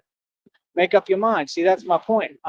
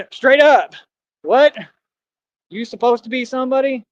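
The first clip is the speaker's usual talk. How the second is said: put-on voice; 6 semitones higher than usual. Shown as a demonstration. Showing listeners his put-on voice, hammed-up. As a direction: show off the fake voice he uses on them. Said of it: "I'm not even using my real speaking voice".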